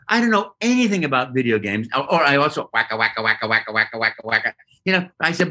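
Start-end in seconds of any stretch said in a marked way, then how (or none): 2.7-4.5 rhythmic, even Pac-Man chomp imitation; imitated game chomping. Actor listing games he knows, imitated chomping noise. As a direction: chant it like a looping arcade sound effect.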